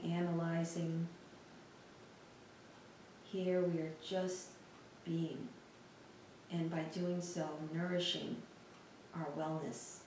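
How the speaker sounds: soft, unhurried meditation voice with long pauses; guided meditation. Real, not acted. Teacher guiding listeners through a lying-down body scan, calm meditation voice. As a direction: guide them gently through a body scan, leaving space between phrases.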